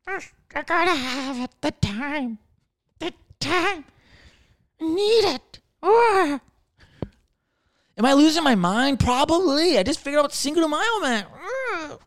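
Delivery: high, frantic Gollum wail; a creature losing his mind; high desperate Gollum cry; Smeagol voice. Retold or imitated. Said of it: "we're all Schmeagol".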